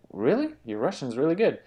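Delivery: quick amazed Really then a compliment; mock surprise. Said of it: "And they say".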